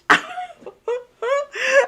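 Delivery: high laugh. Real, not acted. Laughing at the passerby's remark, high laugh.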